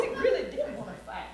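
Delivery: usual speaking voice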